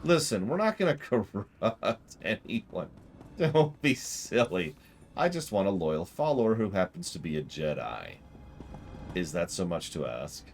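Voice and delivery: ordinary talk, nothing marked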